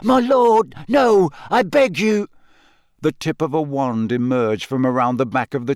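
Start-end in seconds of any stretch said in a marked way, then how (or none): 0.0-2.3 high, loud plea; frightened begging. Acted. Wormtail begging before punishment, frightened plea. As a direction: beg your master for mercy.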